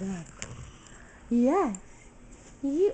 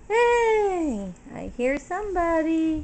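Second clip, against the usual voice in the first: bright-eyed "guess who" call, pitched up; excited alert; 5 semitones higher, 8 dB louder, slower than usual. Real, not acted. Excitedly alerts baby to someone arriving.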